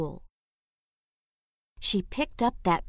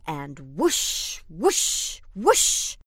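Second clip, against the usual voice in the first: splash the water on, building; rising whoosh; 3 semitones lower, much slower than usual. A narrator's reading. Water sound effect.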